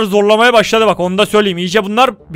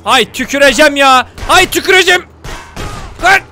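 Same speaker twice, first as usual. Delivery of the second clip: exasperated shout rising higher and louder; angry outburst; 5 semitones higher, 3 dB louder than usual. Real, not acted. Gamer fed up while driving, angry shouting. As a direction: yell it in building fury. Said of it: "Öyle sinirlendim ki".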